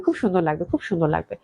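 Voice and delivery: soft, low trailing praise; fading softly. Real, not acted. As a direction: let it fade to a murmur. Repeated praise, much softer.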